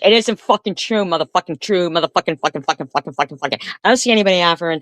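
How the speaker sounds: high, loud furious outburst; real furious yell at her rival. Real, not acted. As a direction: yell the denial, sputtering with rage. Screaming denial in a feud.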